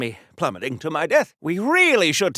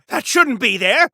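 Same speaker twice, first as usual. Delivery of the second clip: ordinary talk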